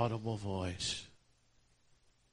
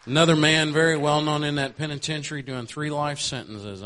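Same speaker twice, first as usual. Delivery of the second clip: loud preaching; 8 semitones higher, 12 dB louder than usual. Real, not acted. Preacher building in fervour.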